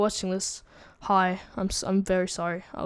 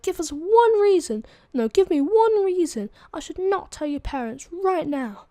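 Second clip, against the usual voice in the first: high, loud principal's demand; emphatic, raised mock scolding; stern ultimatum; 8 semitones higher, 5 dB louder than usual. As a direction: imitate a principal demanding an answer.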